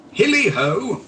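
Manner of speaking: high, loud hearty whoop; jovial cry. A narrator's reading. Fezziwig skipping from his desk, jovial whoop. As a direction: whoop with glee. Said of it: "cried old Fezziwig".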